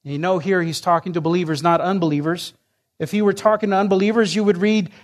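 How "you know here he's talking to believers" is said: ordinary delivery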